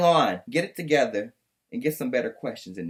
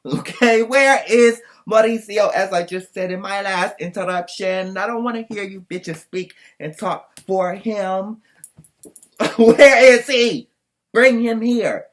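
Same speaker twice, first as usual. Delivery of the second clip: exasperated shout; 5 semitones higher, 9 dB louder, slower than usual. Real, not acted. Demanding Mauricio show up.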